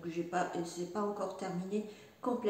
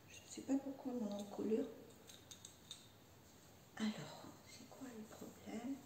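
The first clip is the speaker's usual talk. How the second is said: soft whisper aside to herself; 14 dB quieter than usual. Real, not acted. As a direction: breathe it under your breath, puzzled. Whispers to herself fixing collar.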